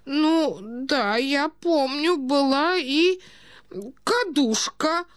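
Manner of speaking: put-on high, slow, dreamy old prince; absent-minded muttering. A narrator's reading. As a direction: drift through a memory, drawing words out. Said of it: "пробормотал бессознательно князь". (князь бессознательно бормочет, высоко и протяжно)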